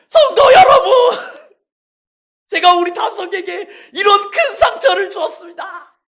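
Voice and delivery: loud, high cry to the congregation; preaching shout. Real, not acted. Pastor confessing to the congregation, loud and high.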